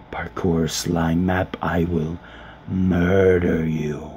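drawn-out furious yell at the map; slow building yell. Acted. Gamer yelling a slow threat at the map.

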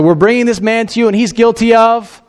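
priests pressing their charge; accusers' voice. Acted.

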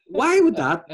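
high loud incredulous question; baffled. Retold or imitated. Pupil baffled by friend's drawing.

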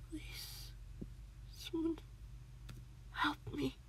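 high, faint, broken plea; gasping. A narrator's reading. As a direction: gasp out each word separately. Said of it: "Cat gasped, finding harder and harder to speak".

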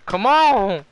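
loud exasperated yell; frustrated shout. Real, not acted. Frustrated at the game.

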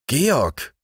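alarmed high cry of a name; startled cry. A narrator's reading. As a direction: call his name in alarm. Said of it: "riefen Edith und Wolfgang, erschrocken".

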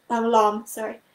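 usual speaking voice

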